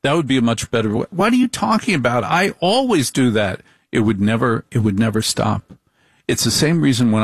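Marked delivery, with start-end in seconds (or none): 1.1-3.6 high, indignant wife voice; defensive wife. Retold or imitated. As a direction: bristle like a wife denying it. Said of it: "wife denies nagging".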